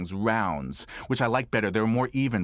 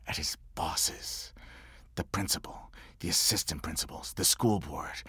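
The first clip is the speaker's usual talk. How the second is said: whispered, much softer; 6 semitones lower, 8 dB quieter than usual. Real, not acted. Telling the audience about the father's anger, hushed whisper. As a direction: hush it down like a secret.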